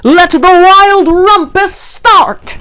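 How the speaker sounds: high, slow, drawn-out proclamation; cried out. A narrator's reading. Max the king declaring the rumpus, excited. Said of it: "cried Max".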